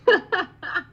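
a short, high, loud burst of laughter; real laugh. Real, not acted. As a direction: laugh out at your own cheeky line. Guest laughs at her own remark.

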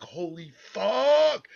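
high, loud and slow, panicked; alarmed exclamation. Retold or imitated. Caught as the girlfriend's mom returns, loud slow alarmed outburst. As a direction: blurt it out in alarm, drawn out.

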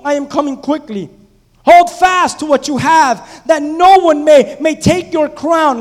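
loud, high scripture reading from the pulpit; preaching at full force. Acted. Pastor thundering the verses to his church, loud preaching. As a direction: proclaim it to the congregation.

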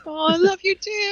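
high squealing gush of affection. Real, not acted. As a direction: squeal it back, overjoyed. Playful reply to love declaration.

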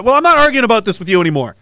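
normal talking voice

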